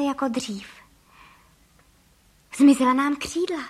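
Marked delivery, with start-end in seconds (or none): 2.6-3.6 louder, excited exclamation; cried out. A narrator's reading. Husband finding himself human, crying out. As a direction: exclaim it in amazement. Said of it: "vykřikl pan Frejka".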